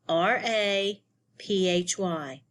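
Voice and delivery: usual speaking voice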